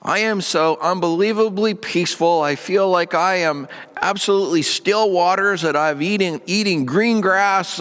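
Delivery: high, gushing prayer of joy; uncontrolled praise. Acted. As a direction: gush to God, carried away. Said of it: "on the other side of the equation".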